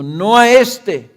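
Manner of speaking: loud, slow crowd cry; slow shouted rejection of the prisoner; crowd shouting. A narrator's reading. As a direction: shout the refusal out slowly. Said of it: "volvieron a gritar diciendo".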